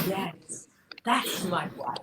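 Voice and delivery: soft, hushed voice of the late husband, ending in a whisper; late father's voice. Retold or imitated. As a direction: speak for Daddy gently, almost a whisper. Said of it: "Daddy is looking down at you today and saying".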